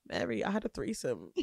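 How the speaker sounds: low, quiet retold confession; imitating friend. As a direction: drop down soft and low, quoting a friend. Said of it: "She was like".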